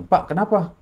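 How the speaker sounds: lightly voiced student checking on a teacher; concerned student.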